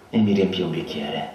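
usual speaking voice

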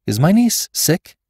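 high, anxious suspicious question; quick suspicion. A narrator's reading. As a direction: pounce on it, pitch jumping up. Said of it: "asked Rebecca with quick suspicion".

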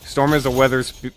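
ordinary delivery

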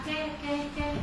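"كا" said softly with a light front k; quiet, easy "كا", vowel kept thin; gentle, light "كا". Shown as a demonstration. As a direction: say "كا" gently, without any heaviness. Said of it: "بالراحة".

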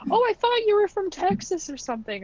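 high, bright, mock amazement; chirpy surprised caller. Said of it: "And they'd be like".

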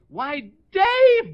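host demos a high sweet phone greeting; sister-in-law voice demo. Shown as a demonstration. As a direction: show her how to coo it. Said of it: "and you say".

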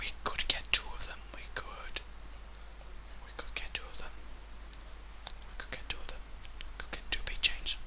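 soft unvoiced whisper. Real, not acted. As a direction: whisper it secretly. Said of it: "Why am I talking like this?".

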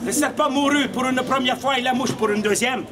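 normal talking voice

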